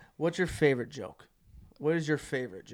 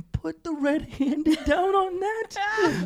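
squeaky laugh-through line; 3 dB louder, much slower than usual. Retold or imitated. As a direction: say it while cracking up. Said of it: "he leans over, and he goes".

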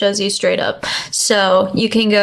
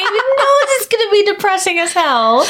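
higher-pitched teasing through real laughter; laughing, pitched-up teasing jab; laughing tease; 12 semitones higher than usual. Real, not acted. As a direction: needle her while cracking up. Teasing friend while laughing.

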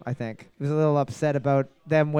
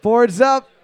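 high, loud catchphrase; loud announcer call; 6 semitones higher, 7 dB louder than usual. Shown as a demonstration. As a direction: call it out to the room. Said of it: "host catchphrase, takes".

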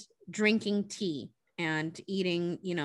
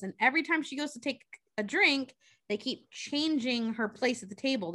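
ordinary talk

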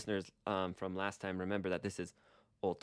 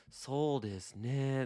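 musing tone; 5 semitones higher, slower than usual. Shown as a demonstration. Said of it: "that's kinda like saying".

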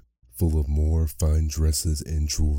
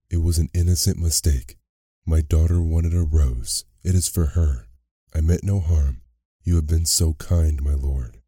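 ordinary delivery, nothing marked

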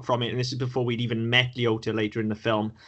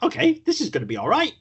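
excited; 11 semitones higher, faster than usual. Retold or imitated. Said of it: "I'm like".

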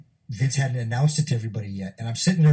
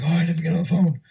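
boss voice; 7 semitones higher, 4 dB louder than usual. Retold or imitated. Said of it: "He's like".

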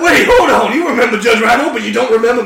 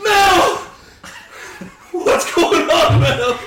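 high crying shout; panicked cry; 9 semitones higher, much slower than usual. Acted. Patient seeing Mel Gibson, high panicked cry. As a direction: cry out in fright.

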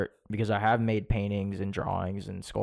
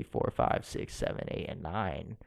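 sultry voice; 5 semitones lower, 6 dB quieter than usual. Pretend. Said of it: "voice sounds as sultry and sexy".

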